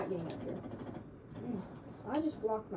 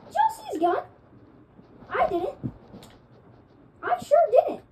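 much higher, incredulous squeal; can't believe it, loud; outraged disbelief; 8 dB louder than usual. Real, not acted. Raging at the enemy's kill.

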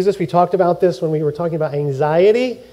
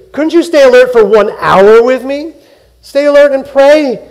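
pleading rebuke; 7 semitones higher, 8 dB louder than usual. Retold or imitated. Jesus scolding sleeping Peter.

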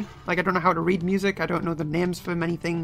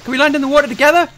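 high and loud, then stretched "Water buddies!"; excited; 10 semitones higher, 6 dB louder than usual. Real, not acted. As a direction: squeal it with glee. Excited about jumping in water.

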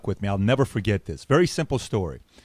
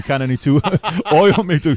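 laughing; 4 semitones higher, 4 dB louder than usual. Real, not acted. Joking about fitting in car.

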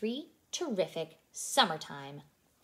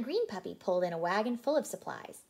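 plain reading voice

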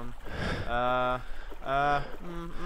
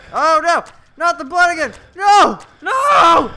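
screaming; 19 dB louder than usual. Real, not acted. Blood rising again, screaming.

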